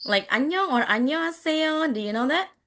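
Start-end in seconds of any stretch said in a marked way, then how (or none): 0.3-0.7 high, sing-out Korean hello; imitated Korean greeting. Teacher showing how Koreans say hello, high imitated greeting. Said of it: "they're going to be saying". 0.9-1.9 high, sing-out Korean hello; imitated Korean greeting. Teacher showing how Koreans say hello, high imitated greeting. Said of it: "they're going to be saying".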